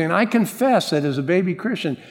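usual speaking voice

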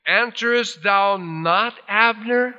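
high, drawn-out scripture call; high questioning call to someone far off; crying out. Retold or imitated. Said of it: "David cried to the people".

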